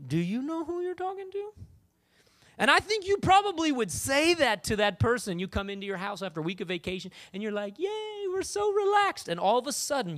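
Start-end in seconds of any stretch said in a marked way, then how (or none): 0.0-1.5 high, softer, mock-offended question; a different, held-back way to pull rank; haughty challenge. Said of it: "might say it a different way". 7.8-9.3 high, drawn-out mock yay; slow, carefree holiday sigh of relief; relaxed contentment. Acting happy return from vacation.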